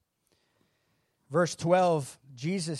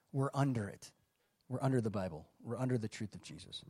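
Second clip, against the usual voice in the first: under leaned on, each phrase falling, much softer; stress on under; 6 semitones lower, 10 dB quieter than usual. Shown as a demonstration. Preacher drives the point home, softly stressing under. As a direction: drop the voice and press under each time. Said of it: "repeating stand under the truth".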